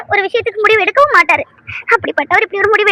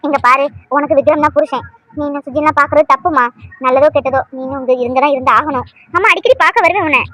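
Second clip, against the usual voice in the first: consoling mother; 6 semitones lower than usual. Acted.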